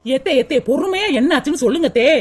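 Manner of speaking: mocking man. Husband scoffing at the mother's fuss, low sarcastic.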